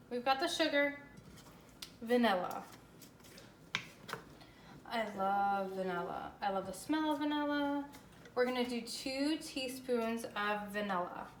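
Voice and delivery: ordinary talk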